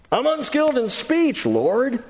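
high, pleading excuse; whiny Moses. Acted. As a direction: plead it up high like a reluctant prophet. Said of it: "note what Moses says".

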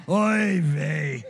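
loud hammed-up Yiddish "Oy vey"; Yiddish accent.

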